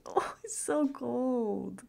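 slow loud shivery whine; cold complaint. Acted. Out of the covers.

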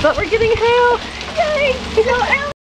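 high, loud, gleeful shouts; excited cheering. Real, not acted. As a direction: cheer at the top of your voice. Cheering the falling hail.

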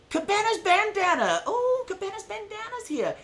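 high laughing greeting; delighted squeal. Real, not acted. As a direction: squeal with joy at a friend.

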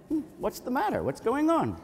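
a hint of an innocent kitty; calm cat voice. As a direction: play the cat, calm and puzzled. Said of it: "like, all calm".

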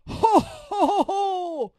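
very high, very loud ho-ho laughter; booming robber laughter. Acted. Robbers roaring with laughter together, very loud ho-ho laugh. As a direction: roar with laughter. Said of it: "lachten die Räuber".

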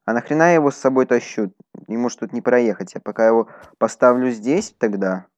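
ordinary talk, nothing marked

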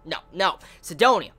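usual speaking voice

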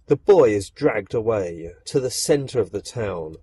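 ordinary delivery

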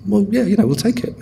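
high-pitched offhand shrug; imitating a nonchalant official; casual mock shrug. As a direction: play it breezily unbothered. Border officials casually helping themselves.

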